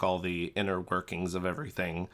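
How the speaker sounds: ordinary talk, nothing marked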